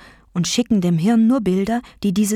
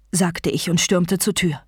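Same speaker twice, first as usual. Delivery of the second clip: ordinary delivery, nothing marked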